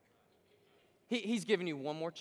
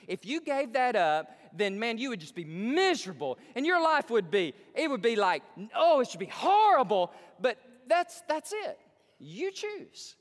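loud fervent; 6 semitones higher, 8 dB louder than usual. Acted. Preacher mocking sinners' fear.

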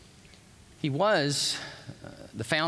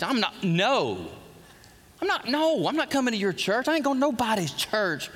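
mock indignant refusal; 6 semitones higher than usual. Mock refusal of church invite.

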